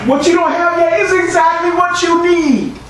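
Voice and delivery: high ringing preacher's shout; preaching shout. Real, not acted. Pastor to the congregation, a high ringing shout. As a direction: lift the congregation with it.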